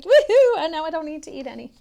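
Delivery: high loud whoop then fast babble; cheer, then rattle it off; gleeful woohoo. Retold or imitated. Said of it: "He's like".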